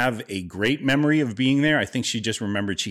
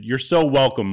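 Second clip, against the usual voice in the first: pointedly loud, a touch higher; loud sarcastic thanks; 4 semitones higher, 6 dB louder than usual. Retold or imitated. Loud sarcastic thanks to mom over the brother. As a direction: make sure the deaf mother hears it. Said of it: "And I said really loudly".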